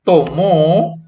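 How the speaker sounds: "tomó" with a long, rising final O; stress on final syllable. Shown as a demonstration. Teacher picking out past verbs, stress on the O of "tomó". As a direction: lean on the last O of "tomó". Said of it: "acento a la O".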